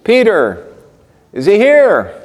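men at the gate calling for Peter. Retold or imitated. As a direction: shout his name to the house from the gate. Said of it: "and they're calling".

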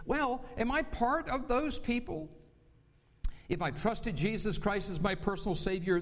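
ordinary delivery

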